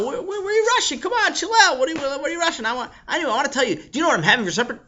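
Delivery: put-on high, excited kid pestering his friend; pushy little boy, voice up high; child Sammy. Kid chasing his friend down, high and eager.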